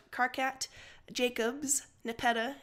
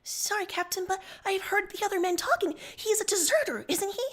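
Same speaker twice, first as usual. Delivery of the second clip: higher, louder accusing junior officer; Jacobs voice; 8 semitones higher, 5 dB louder than usual. Acted. Jacobs confronting the captain about Sullux, high and accusing. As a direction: raise the pitch and accuse. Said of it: "She says".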